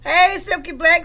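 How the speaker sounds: high, loud shout-out; calling out a greeting. Real, not acted. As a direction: holler hello to a newcomer. Hailing a viewer joining.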